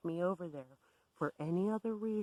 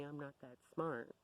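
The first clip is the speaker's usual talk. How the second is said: ordinary delivery, nothing marked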